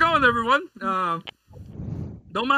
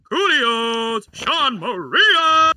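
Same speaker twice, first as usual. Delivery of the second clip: ring announcer; 6 semitones higher, 5 dB louder, slower than usual. Shown as a demonstration. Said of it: "do that hit introduction".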